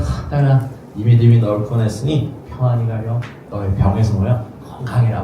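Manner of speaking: 딸에게 부드럽고 조용하게 축복하듯; 한숨 섞어 따뜻이 다독이는 아버지; 사랑 가득한 격려. Retold or imitated. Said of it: "사랑 가득한 눈으로 자신의 딸을". (예수님이 딸에게 조용히 다정하게)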